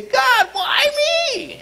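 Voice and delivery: whining cry. Retold or imitated. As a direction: cry out in self-pity. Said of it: "come to him and say".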